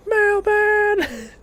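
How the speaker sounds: high, loud crazed scream of one word; unhinged scream. Retold or imitated. Gamer copying a cartoon guy screaming mailman. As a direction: shriek it like a man losing it. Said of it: "I'm screaming mailman".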